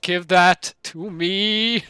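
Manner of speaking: slow, loud, laughing demand; laughing greedily. Real, not acted. Great card drawn, laughing.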